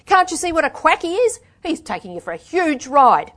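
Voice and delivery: high, loud, exasperated; angry warning. A narrator's reading. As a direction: make them see they're being conned.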